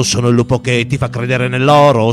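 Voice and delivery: ordinary talk, nothing marked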